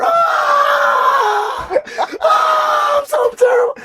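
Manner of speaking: high, loud, hammed-up crying wail; mock sobbing wail. Retelling a breakdown to the podcast host, hammed-up sobbing wail. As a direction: wail it like a grown man bawling in class. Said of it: "I think I'm done crying".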